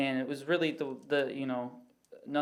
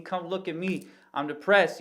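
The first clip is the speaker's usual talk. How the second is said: mock yell; 4 semitones higher, 5 dB louder than usual. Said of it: "you're yelling at other people".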